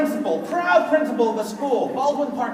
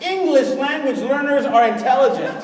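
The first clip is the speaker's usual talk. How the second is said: ordinary delivery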